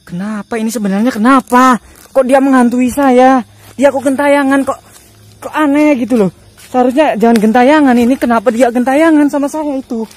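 high, loud complaint at being haunted; worked-up, loud bewilderment; exasperated protest. Real, not acted.

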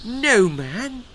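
high, loud gasp of dismay; shocked exclamation. A narrator's reading. Queen horrified at the name, exclaiming. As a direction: cry out in horror. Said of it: "exclaimed Queen Letitia".

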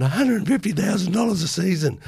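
put-on a nervous young player squeaking out his price to Packer; squeaky voice. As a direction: voice cracking up high on the big number. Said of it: "the voice went all squeaky".

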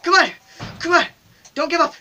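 high, loud, desperate shouts; frantic cheering. Real, not acted. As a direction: yell at your player not to quit. Pleads with failing bottle to jump.

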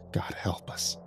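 breathless whispered prayer; captain prays at the bones, in a whisper; frightened whisper. Acted. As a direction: whisper it in horror. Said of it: "he stammered".

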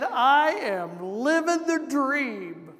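slow, drawn-out mock cheer on living; imitating a man who says it with heavy sarcasm; a man answering how are you doing, dry sarcastic drawl. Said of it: "he said it in a very sarcastic tone".

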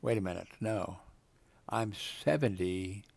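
normal talking voice

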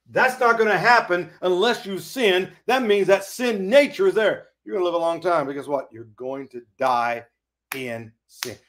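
normal talking voice

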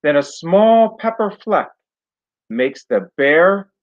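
put-on lively storybook read-aloud for kids; reading to children. A narrator's reading. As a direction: tell it like bedtime story to a class. Teacher reads picture book aloud.

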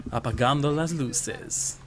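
put-on slow Sombra-style Spanish accent. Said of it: "How's my Spanish accent".

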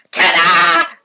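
loud, high showman's ta-da; mother rising from the sheet with a loud ta-da; triumphant shout. Acted. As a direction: burst out like a magician's reveal.

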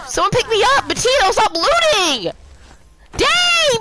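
high frantic calls for help; frantic yelling. Real, not acted. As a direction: yell in a panic for a revive. Downed, calling teammate.

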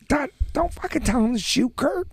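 high pitched yell at Kurt; yelling at her husband from the stands, high and strained; mock yell. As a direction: screech it across the gym.

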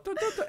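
high sung notes; sung ending. Retold or imitated. Song's end hummed high. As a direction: hum the closing notes up high.

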